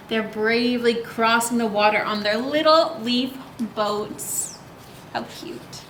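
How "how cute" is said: whispered aside. Real, not acted. Aside on cute picture.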